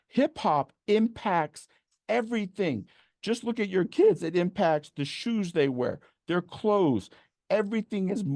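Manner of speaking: getting worked up about hip hop's reach. Real, not acted. Speaker to audience, fired up about hip hop's reach. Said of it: "I get a little worked up".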